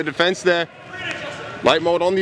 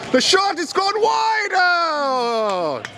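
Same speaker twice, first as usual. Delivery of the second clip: shot goes wide, pitch leaps high; hammed-up commentator thrill; high excited call of a missed shot; excited commentary; 11 semitones higher, much slower than usual. Commentator's big moment.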